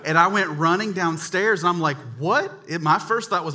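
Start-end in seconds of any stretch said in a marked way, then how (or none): 2.2-2.5 high, loud, rising "what"; astonished. Retold or imitated. Boy running downstairs with his discovery, astonished. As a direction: blurt it in mock shock.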